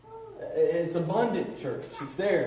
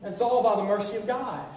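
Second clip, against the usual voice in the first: ordinary talk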